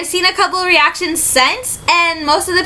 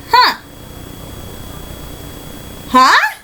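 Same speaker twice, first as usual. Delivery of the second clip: puzzled huh; 7 semitones higher than usual. Retold or imitated. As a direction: perk up, confused and intrigued. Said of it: "And I'm going".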